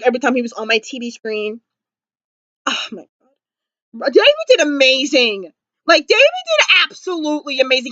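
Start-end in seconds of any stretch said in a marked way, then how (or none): none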